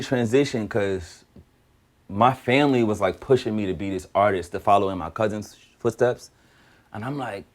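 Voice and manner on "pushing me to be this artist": normal talking voice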